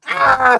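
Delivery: loud high mock scream; hammed-up pain yell; pepper spray scream. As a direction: shriek as if sprayed.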